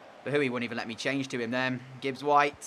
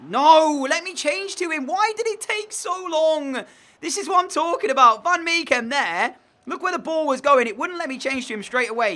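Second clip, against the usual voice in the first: much higher and louder, yelling at the screen; furious rant; 12 semitones higher, 5 dB louder than usual. Real, not acted. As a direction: vent your fury at the game. Raging at the game's player switching.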